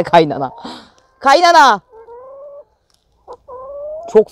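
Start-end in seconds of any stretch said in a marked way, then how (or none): 1.2-1.8 high, loud teasing call. Real, not acted. Calling the grumpy hen Kaynana, loud and high. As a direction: shout the name like a playful summons.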